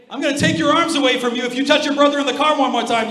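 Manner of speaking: loud, high-pitched hammed-up threat; mock parental threat. Parent threatening a child in the car, mock angry. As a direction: threaten the kid at full volume, playacting an angry parent.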